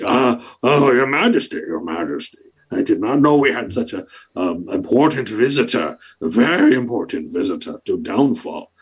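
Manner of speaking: fawning, breathless creature; awed bullywug. Acted. Bullywug greeting the monarch, fawning. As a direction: gush at royalty.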